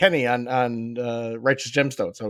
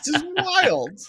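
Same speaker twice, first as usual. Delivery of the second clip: blown away. Retold or imitated. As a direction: gasp at it like a stunned kid watching. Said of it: "being blown away".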